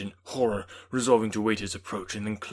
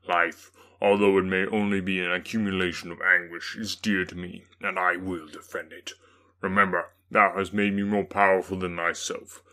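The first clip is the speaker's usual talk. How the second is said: the demon; 7 dB louder than usual. A narrator's reading. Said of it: "He easily eluded me and said".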